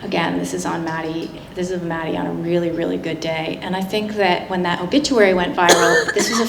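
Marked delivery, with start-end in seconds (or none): none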